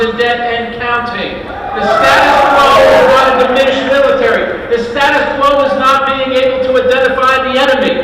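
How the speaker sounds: loud, rousing rally speech, swelling to a shout and then easing; rally oratory. Acted. A candidate firing up a rally crowd, loud and rousing. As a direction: pump up the crowd line by line.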